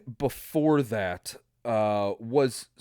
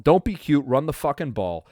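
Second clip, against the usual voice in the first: retold yell; 3 semitones higher, 7 dB louder than usual. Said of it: "I yelled".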